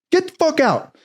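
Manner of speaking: very high-pitched mock order; indignant high order; outraged dismissal. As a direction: imitate a chief throwing someone out. Chief throws recruit out.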